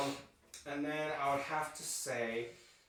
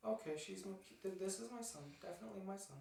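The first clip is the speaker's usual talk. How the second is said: defeated mother; 6 semitones higher, 10 dB quieter, faster than usual. Retold or imitated.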